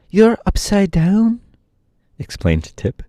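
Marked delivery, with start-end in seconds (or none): 0.1-1.4 high-pitched Tip, drawn out; high slow boy. A narrator's reading. Tip answering the puzzled horse, slow high voice.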